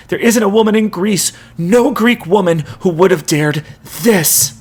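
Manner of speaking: high, loud, anguished outrage; furious rant. A narrator's reading. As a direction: rage at her through grief. Jason's furious accusation.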